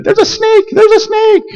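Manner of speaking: high, frightened squeal; scared girls' shriek. Retold or imitated. Preacher voicing girls spotting a snake, high frightened shriek. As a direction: shriek it like a terrified girl.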